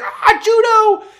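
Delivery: very high, loud, drawn-out delighted cry; overjoyed squeal. Acted. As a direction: squeal the name on spotting a crush. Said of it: "she's so happy to see him".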